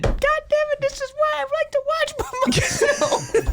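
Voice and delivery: very high-pitched, strained mock rage; hammed-up furious squawk; retold fan outburst. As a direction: re-enact snapping at everyone in the room. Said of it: "It really does make you nuts".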